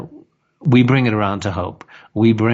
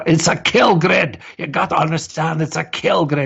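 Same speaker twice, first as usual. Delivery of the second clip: higher intense doom voice, mock Mark; imitating Mark; 7 semitones higher than usual. Host mimics guest Mark's intense warning, higher and intense. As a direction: ham up a grim warning about a kill grid. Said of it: "with his accent and his personality as well".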